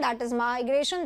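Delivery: ordinary talk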